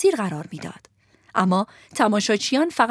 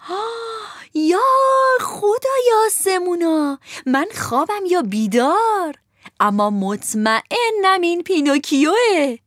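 high, dramatic puppet cry; theatrical Harlequin; 8 semitones higher, 3 dB louder, slower than usual. Acted. Harlequin points at Pinocchio in the crowd, high and theatrical. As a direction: declaim like a stage clown in shock. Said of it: "با لحنی نمایشی فریاد زد".

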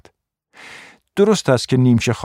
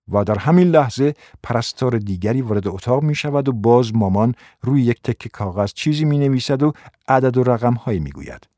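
ordinary delivery, nothing marked

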